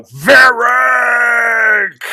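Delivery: loud calling shout. Acted. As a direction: yell the name across the distance. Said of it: "You hear a familiar voice behind you go".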